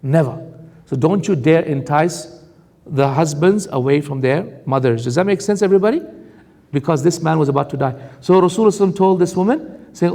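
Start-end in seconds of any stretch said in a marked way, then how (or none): none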